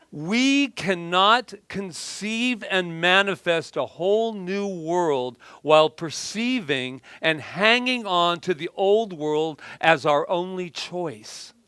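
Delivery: slow, weighty reading of a quote; slow deliberate reading. Shown as a demonstration. Speaker reading her quote to the audience, slow and deliberate. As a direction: lay out each word slowly for the room. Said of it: "I'll read it again".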